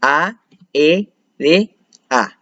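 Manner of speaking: "A, E, D, A" as four separate syllables. Shown as a demonstration. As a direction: pause after each of "A, E, D, A". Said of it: "separadas en varias sílabas".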